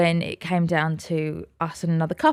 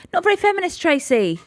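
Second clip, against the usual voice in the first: sarcastic retort; 10 semitones higher than usual. Retold or imitated. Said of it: "I'm like".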